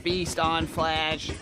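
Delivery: very slow zombie moan. Acted. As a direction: drawl it like a hungry zombie. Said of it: "Must eat brains".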